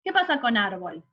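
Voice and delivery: ordinary talk, nothing marked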